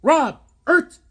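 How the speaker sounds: high, loud back-and-forth yells; shouted name game. Retold or imitated. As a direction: bellow the syllables across the gas station. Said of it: "I go".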